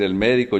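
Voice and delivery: "médico" stressed on MÉ; stress on first syllable. Shown as a demonstration. Teacher contrasting three words, MÉ-di-co stress on "médico". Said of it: "no es lo mismo decir".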